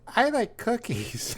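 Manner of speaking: put-on very high, slow small-child voice; a tiny child on a game headset; little kid voice. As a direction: say it sweetly like a five-year-old. Five-year-old niece on headset.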